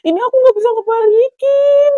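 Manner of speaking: loud, very high wail; frantic high-pitched complaint, acted out; panicked. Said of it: "aku di situ panik ya".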